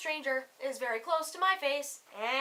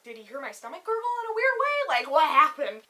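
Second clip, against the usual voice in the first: mock panic; 3 semitones higher, 10 dB louder than usual. Left behind wondering what went wrong, mock-panicked wail.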